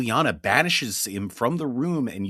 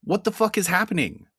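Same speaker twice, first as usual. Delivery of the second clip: high stunned exclamation; shocked; 10 semitones higher than usual. Acted. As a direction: blurt it out in disbelief. Stunned by time jump.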